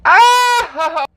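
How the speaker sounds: high squealed cry of delight. Real, not acted. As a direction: let out a high, thrilled squeal. Delighted at trying the product.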